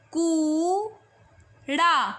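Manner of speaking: कूड़ा with the ऊ pulled long and slow; slow, drawn-out कूड़ा; stretched vowel. Shown as a demonstration. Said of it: "देखिए कैसे लंबा खींच के बोलता है".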